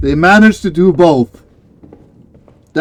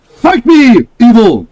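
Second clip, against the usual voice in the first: loud, high holy warrior proclamation; mock biblical prophet; 9 semitones higher, 5 dB louder than usual. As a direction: smite them with righteous fervor. Holy warrior challenge to evil.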